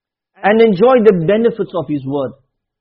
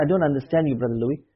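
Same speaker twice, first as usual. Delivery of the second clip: soft, lower mock complaint; a puzzled churchgoer objecting gently; quiet puzzled objection; 7 semitones lower, 9 dB quieter, faster than usual. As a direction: murmur the protest under your breath. Imagined congregant objection.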